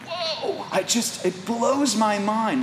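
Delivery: breathy, high gasp of wonder; awed whoa. Retold or imitated. Husband recalling sunsets with his wife, awed. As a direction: let the sunset take your breath.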